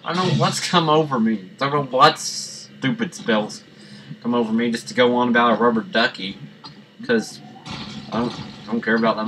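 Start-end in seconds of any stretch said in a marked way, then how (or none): none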